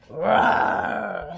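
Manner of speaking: loud hammed-up roar; a playful pretend monster roar; mock dragon roar. A narrator's reading. Spud in a dragon costume roars to scare the others, mock roar.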